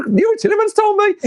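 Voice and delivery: high, loud gleeful reveal; triumphant squeal. Retold or imitated. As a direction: crow the name like a secret. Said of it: "I said".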